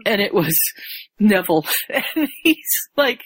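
normal talking voice

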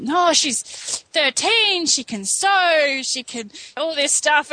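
high, drawn-out mock coaxing; parents' sales pitch. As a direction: sing her praises slowly, high-pitched. Voices parents touting their daughter.

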